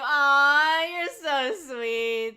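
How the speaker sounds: loud, drawn-out aww; big, stretched-out fond exclamation; touched gushing. Real, not acted. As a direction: gush slowly, swelling with affection. Touched by a kind offer.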